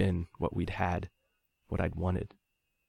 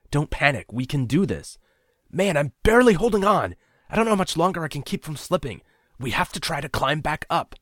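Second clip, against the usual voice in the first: urgent strained plea; 10 semitones higher, 6 dB louder than usual. A narrator's reading. Ryan urging calm while slipping.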